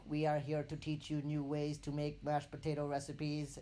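an alien envoy making a solemn announcement. Retold or imitated. [name] voicing aliens to Toby, a mock alien voice.

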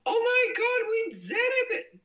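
high squealing cheer; gasping high-pitched celebration; excited squeal. Real, not acted. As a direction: shriek with delight at the win. Thinks level one hundred reached.

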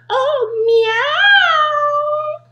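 high, loud meowing cat; the cat wakes and eyes its breakfast, high and loud. Acted. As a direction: meow like a sly cat. Cat speaks.

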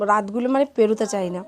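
usual speaking voice

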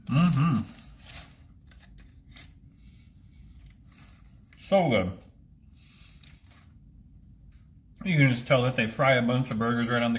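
chewing while talking; talking with food in mouth; mouth full. Real, not acted. Seen 3: Bit burger at -1; closed mouth, chewing while holding it throughout.

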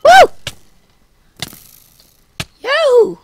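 high shouted yahoo of joy; excited cheer. Real, not acted. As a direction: whoop it out in triumph. Victory cheer.

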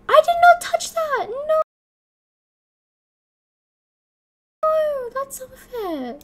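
very high, wailing protest, stretched out at the end; outraged squeal. Real, not acted. Player protesting an unfair loss, outraged high squeal. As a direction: shriek your protest at the unfairness.

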